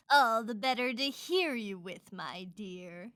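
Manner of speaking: low, slow purr sinking at the end; sly wolf. Acted. Disguised wolf answering Red, low, slow and sly. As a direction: draw it out low and menacing.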